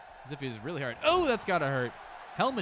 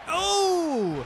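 excited yell; 12 dB louder than usual. Real, not acted. Big move in the match.

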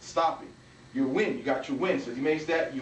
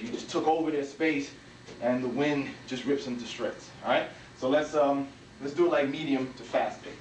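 usual speaking voice

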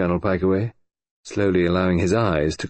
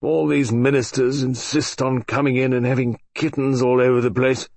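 put-on grumpy old colonel; 6 semitones higher than usual. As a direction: complain like a fed-up old soldier. Pikeaway grumbles.

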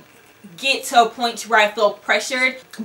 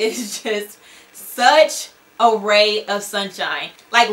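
usual speaking voice

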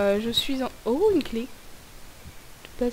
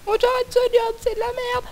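fed-up worker voice; 10 semitones higher than usual. Retold or imitated. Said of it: "Je vais dire à mon employeur".

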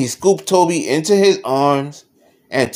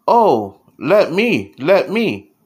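higher, louder eager little plea; 4 semitones higher, 5 dB louder, slower than usual. A narrator's reading. Little mouse Toby begging Daddy for a turn, eager. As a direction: jump in with keen excitement.

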